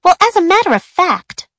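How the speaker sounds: put-on snooty girl voice. A narrator's reading. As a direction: start a put-down sweetly. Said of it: "she began".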